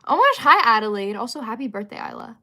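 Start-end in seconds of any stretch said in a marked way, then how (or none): 0.1-1.2 thrilled, loud hello; excited greeting. Real, not acted. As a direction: greet a favourite viewer with delight.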